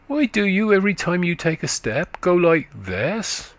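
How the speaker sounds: put-on high, quick childish voice of a country boy; little boy chatting up to a tired woman, high and eager; chattering little boy. A narrator's reading. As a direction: prattle like a small eager lad. Boy imitates her limp.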